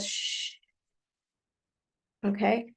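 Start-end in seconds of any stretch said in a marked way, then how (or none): 0.0-0.5 a soft, unvoiced shh to a crying toddler; whispered shush; soothing shush. Shown as a demonstration. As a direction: hush a tired child gently. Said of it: "and to be told".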